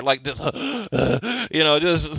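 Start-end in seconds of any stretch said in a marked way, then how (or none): 0.5-1.5 a mock high gasp; imitating a fighter catching his breath on the ropes; imitated gasp for air. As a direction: gulp in air like a tired boxer. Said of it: "just sucking in air".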